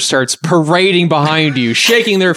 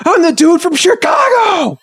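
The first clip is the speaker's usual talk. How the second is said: hammed-up shout. Acting the burger guy.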